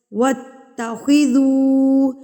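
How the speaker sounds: وَاتَّخِذُوا with ذو lengthened, slow; stretched du. Shown as a demonstration. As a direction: stretch ذو one count. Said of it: "ذو کو میں نے کھینچا ہے". (ذو کھینچ کر، مد کے ساتھ)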